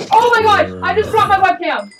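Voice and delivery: high, loud burst of alarm; startled panic. Real, not acted. Gamer dropping the webcam in a fright, panicked cry. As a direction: blurt it out in a panic.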